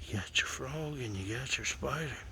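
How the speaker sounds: hushed whisper. Real, not acted. Man watching a big spider whispers in surprise. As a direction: whisper so the critters aren't disturbed.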